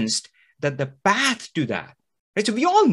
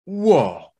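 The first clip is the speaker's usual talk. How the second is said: amazed whoa; 5 semitones higher, 5 dB louder than usual. Retold or imitated. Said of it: "they go".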